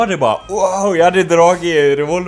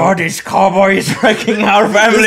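mock outrage; 6 semitones higher, 7 dB louder than usual. Mock outrage about family fights.

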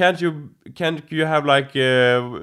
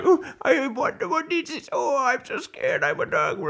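much higher scared dog voice; high timid dog; 12 semitones higher, 4 dB quieter than usual. Retold or imitated. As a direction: squeak like a frightened pet.

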